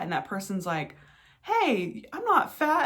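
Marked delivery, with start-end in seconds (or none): 1.4-2.9 high-pitched imitation of an offended friend; mock indignation. Friend objects to being called fat, mock indignant. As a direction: protest in a much higher voice.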